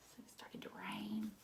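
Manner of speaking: hushed whisper of rain; soft whisper. Acted. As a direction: whisper like falling drizzle. Quiet story aside.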